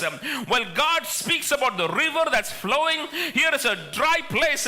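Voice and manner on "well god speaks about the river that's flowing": ordinary talk, nothing marked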